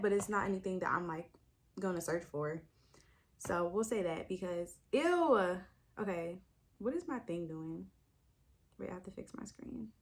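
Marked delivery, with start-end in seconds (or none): 4.9-5.6 a quick, higher, louder ew of disgust. Real, not acted. Reader reacting to the next trope with a quick disgusted ew. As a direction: recoil at the trope. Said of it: "I said ew".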